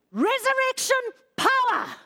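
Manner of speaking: high, impassioned preaching; very emotional. Real, not acted. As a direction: proclaim it with swelling emotion. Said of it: "I'm getting very emotional".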